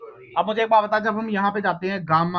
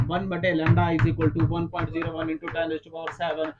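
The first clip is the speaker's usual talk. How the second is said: slow, measured recitation of formula; teacher reciting the formula to memorise; slow formula dictation; 4 semitones lower, 3 dB quieter, slower than usual. Shown as a demonstration. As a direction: read the formula out slowly for memorising. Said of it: "formula याद रखो".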